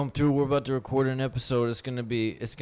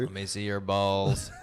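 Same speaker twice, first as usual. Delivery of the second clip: flat synthetic robot voice; 2 semitones lower than usual. Pretend. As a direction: sound like a glitchy machine. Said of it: "do the robot voice".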